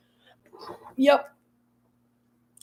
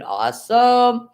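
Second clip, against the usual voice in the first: excited; 6 semitones higher, 8 dB louder, much slower than usual. Real, not acted. Streamer cheering a chat message.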